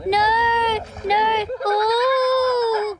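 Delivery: high, drawn-out wail of dread; alarmed cry. Real, not acted. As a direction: cry out in horror at what you see. Reacting in alarm to animals.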